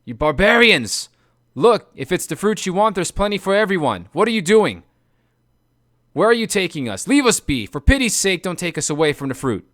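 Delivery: high, loud pleading; desperate protest. Acted. Lotus eaters to their captors, loud pleading. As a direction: beg and protest at once.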